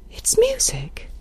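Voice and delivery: high, hushed and slow with dread; frightened, softened high voice; fearful trembling. A narrator's reading. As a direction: whisper it out in fright. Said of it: "shaking from head to foot".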